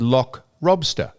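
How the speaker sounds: "lobster" said as "robster", after "lock" for rock; L and R transposed. Retold or imitated. He quotes the woman's order, swapping L and R in "Lock lobster". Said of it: "they, they transpose".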